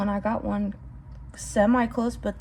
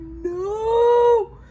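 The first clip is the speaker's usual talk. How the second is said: high, louder cry of dread; frightened cry; 10 semitones higher, 5 dB louder than usual. Retold or imitated. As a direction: yelp it as if a snake moved. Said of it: "and I'm like".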